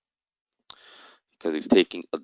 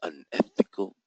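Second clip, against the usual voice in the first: under-the-breath unvoiced aside, much softer; sly muttered aside about market makers; muttered insult; 6 dB quieter, slower than usual. Real, not acted. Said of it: "excuse me, I didn't say that".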